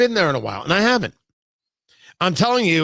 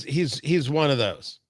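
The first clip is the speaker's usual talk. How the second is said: normal talking voice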